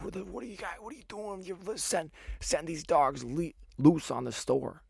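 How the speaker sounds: retold yelling. Bodega man yelling at me over people searching his store, high mock yell. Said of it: "like, yelling at me".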